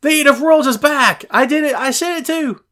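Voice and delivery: high, loud cheering fan voice; excited fans shouting. Retold or imitated. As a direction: cheer like a thrilled crowd. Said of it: "people said".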